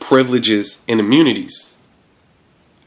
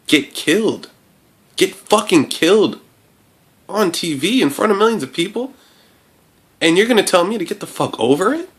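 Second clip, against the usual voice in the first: furious rant; 10 semitones higher than usual. Real, not acted. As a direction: let the outrage boil over at them.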